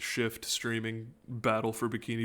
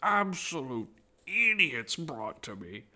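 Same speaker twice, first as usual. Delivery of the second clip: villain voice; 4 semitones higher, slower than usual. Acted. Voicing the villain in the game.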